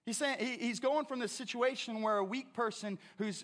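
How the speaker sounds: ordinary delivery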